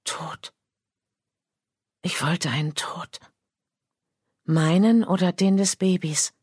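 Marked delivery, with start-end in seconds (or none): 0.0-3.2 hushed, breathy whispered confession; barely voiced, haunted admission. A narrator's reading. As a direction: whisper it like a dark secret. Confessing a dark wish in whisper.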